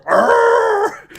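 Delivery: loud high mock roar; monster roar. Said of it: "He just appears, like".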